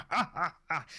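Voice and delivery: high, staccato "ha-ha" laugh; character's mock laugh. Game master acts character laughing.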